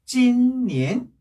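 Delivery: "찐니엔" with long level "찐", then rising "니엔"; first-second tone. Shown as a demonstration. Teacher joining the syllables, "찐니엔" level then rising. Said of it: "일 성, 이 성일 때는".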